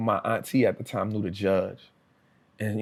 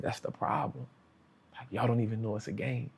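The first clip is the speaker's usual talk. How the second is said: hushed; 8 dB quieter than usual. Retold or imitated. Cellmate's quiet wisdom retold.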